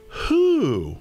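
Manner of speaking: high, stretched-out who; high hooting who. Acted. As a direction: call who high and long. Bear's repeated question.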